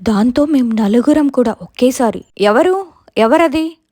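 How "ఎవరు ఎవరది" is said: high, louder call into the dark. Retold or imitated. Four friends calling out to a crying voice in the dark, loud and high. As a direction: call out who's there, a bit scared. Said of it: "అని పిలిచాము".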